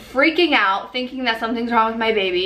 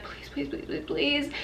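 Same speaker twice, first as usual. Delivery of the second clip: hushed, higher-pitched string of pleases; retold panicked pleading; 4 semitones higher, 12 dB quieter than usual. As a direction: mimic frantic begging under your breath. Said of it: "freaking out".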